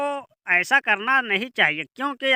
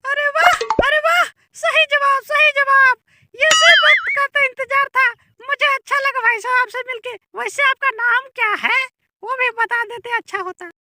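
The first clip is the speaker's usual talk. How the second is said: very high-pitched delighted onlooker; squeaky comic bystander. Acted. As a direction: play an amused little squeaky-voiced character. (बहुत ऊँची, पतली मज़ाकिया आवाज़)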